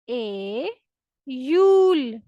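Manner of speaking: ए then slow यूल; Russian iyul. Shown as a demonstration. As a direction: split ए and यूल apart. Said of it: "next हमारे पास है जुलाई". (ए... यूल, अलग-अलग बोलें)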